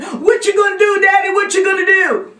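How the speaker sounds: very high, loud, wailing plea; panicked child's scream. Acted. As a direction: shriek desperately for Daddy to act. Panicked boy begging his father.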